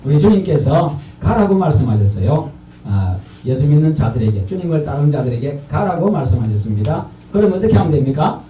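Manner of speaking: loud, emphatic sermon delivery; forceful preaching. Real, not acted. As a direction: preach it out to the congregation, loudly. Preaches call and response at force.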